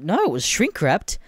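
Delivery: normal talking voice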